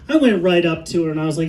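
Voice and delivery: normal talking voice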